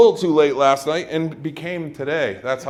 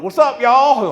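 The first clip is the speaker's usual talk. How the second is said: loud, high Texas holler; Texas accent; 11 semitones higher, 8 dB louder, slower than usual. Acted. Doctor busts into an operating room, Texas accent. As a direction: burst in with a big Texas greeting. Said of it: "I wish you had the Texas accent".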